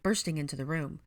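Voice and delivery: plain reading voice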